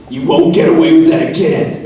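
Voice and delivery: dramatic American accent, pitched high and loud. Shown as a demonstration. Actor demoing dramatic dialogue in an American accent. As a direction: say it like an American TV villain threat. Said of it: "you know, American accent".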